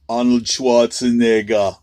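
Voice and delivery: poor imitation Austrian accent; badly faked Austrian action-star accent; Arnold Schwarzenegger. Shown as a demonstration. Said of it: "that's not a very good Arnold".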